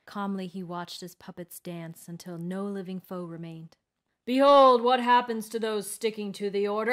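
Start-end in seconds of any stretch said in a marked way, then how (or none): 4.3-6.9 loud villain's proclamation; booming, triumphant warrior shout. A narrator's reading. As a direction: shout it out as a menacing warning to all. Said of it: "he shouted".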